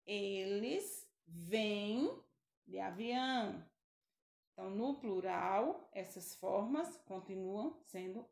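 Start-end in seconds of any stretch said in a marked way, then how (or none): 0.1-3.6 eles vêm spoken very slowly; slow example. Shown as a demonstration. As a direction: stretch vêm for the class. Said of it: "Por exemplo". (eles vêm bem devagar)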